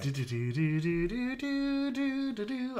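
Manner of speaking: long, high do-do-do drum roll, rising; mock drum roll. Building up to the number one film, drawn-out mock drum roll. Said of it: "Right, drum roll".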